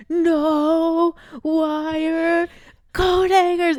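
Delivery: high, loud, drawn-out mock shriek; hammed-up screaming movie mother; Mommy Dearest. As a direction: blast out each word in pretend hysteria. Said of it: "It's a crazy clip".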